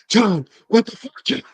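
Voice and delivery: high, loud, stretched-out commentator outcry; Michael Cole. Retold or imitated. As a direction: shout it from the announce desk in disbelief. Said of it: "You hear Michael Cole in the background".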